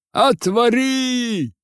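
long, high-pitched holler at the gate; shouted call. A narrator's reading. Students shouting at the gate to be let in, drawn-out yell. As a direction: cry out, stretching the word high. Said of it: "и закричали".

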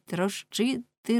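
ordinary delivery, nothing marked

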